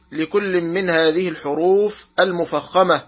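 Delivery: ordinary talk, nothing marked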